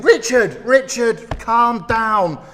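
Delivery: high, loud mock calls of his name; congregation teasing. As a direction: hush the overexcited preacher. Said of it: "you could be saying".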